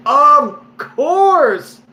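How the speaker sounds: high, loud, drawn-out exclamation; exclaiming. Real, not acted. Drunk storyteller defending himself to viewers, high loud exclaiming. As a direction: cry it out like it's blindingly obvious. Exasperated comic insistence on being drunk.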